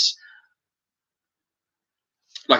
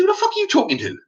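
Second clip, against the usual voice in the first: much higher, outraged challenge; retold indignant retort; faster than usual. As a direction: fire it back, pitch jumping up. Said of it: "I said".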